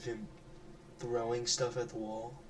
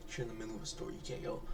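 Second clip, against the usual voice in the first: ordinary talk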